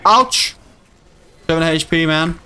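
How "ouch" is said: sharp high "Ouch!"; sudden pained yelp. Real, not acted. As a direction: yelp as you get hit. Gets hit in game.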